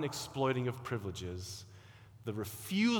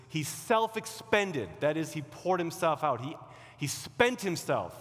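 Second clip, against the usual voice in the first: preaching; 4 semitones higher, 4 dB louder than usual. Acted. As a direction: proclaim it with full conviction. Preaching the climax.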